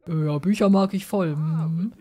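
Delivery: a hint of deep bass; extremely deep bass voice. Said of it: "ich muss ihn immer tief sprechen".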